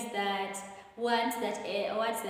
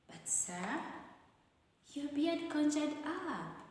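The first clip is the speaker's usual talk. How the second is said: surprised reading; 6 semitones higher, 4 dB quieter than usual. Shown as a demonstration. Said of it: "touch your chin".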